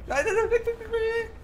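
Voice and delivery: high frantic cries of no; panicked shriek. Real, not acted. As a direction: scream at it to go away. Killer chasing, yelling away.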